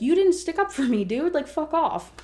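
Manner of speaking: high, indignant mock outburst; pissed off. Girlfriend confronting him for not sticking up, pissed. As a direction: act out a touch of annoyed pushback. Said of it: "She's pissed because she's like".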